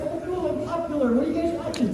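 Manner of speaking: high, chirpy retold delight; naively pleased. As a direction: play up being flattered and curious, high-pitched. Acts out his pleased younger reply.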